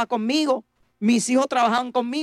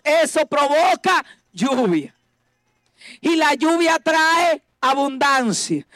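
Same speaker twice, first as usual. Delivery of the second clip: raised, slow, hammering sermon lines; slow preaching refrain; 4 semitones higher, slower than usual. Acted. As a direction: pound out each line slowly.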